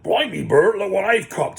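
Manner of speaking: a gruff troll showing off his catch. Acted. Troll William speaks.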